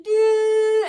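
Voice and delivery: long, loud, high honk; imitated car horn. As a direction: hold it like a blaring horn. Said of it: "like after honking".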